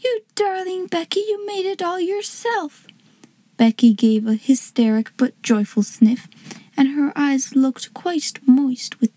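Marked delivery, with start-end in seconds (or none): 0.0-2.9 high, soft and affectionate; delighted cry. A narrator's reading. Girl thanking the maker of her present, delighted. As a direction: gush gently in a girl's high voice. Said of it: "cried Sarah".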